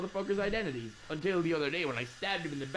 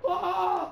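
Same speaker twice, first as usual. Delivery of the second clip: startled yelp; 13 semitones higher, 6 dB louder than usual. Real, not acted. Startled while climbing the ladder.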